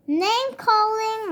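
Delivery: high slow reminder, mildly prim; a little sister's prim correction; reminding. A narrator's reading. Sister reminds Papa about name calling, prim. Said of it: "reminded Sister".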